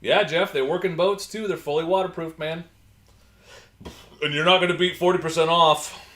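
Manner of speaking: loud, upbeat product hype; excited sales pitch. Real, not acted. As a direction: sell it with big energy. Hyping the product.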